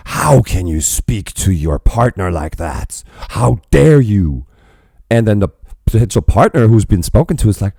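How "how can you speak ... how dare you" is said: slow, drawn-out scandalized protest; hammed-up offended onlooker; mock furious outrage. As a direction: drag out each word in put-on indignation. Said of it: "woke and furious folk saying".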